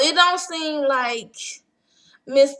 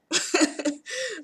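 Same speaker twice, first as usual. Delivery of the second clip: short laugh; 8 semitones higher, 7 dB quieter than usual. Real, not acted. Laughing at her contract terms.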